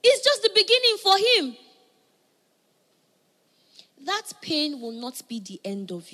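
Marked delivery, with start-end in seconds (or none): none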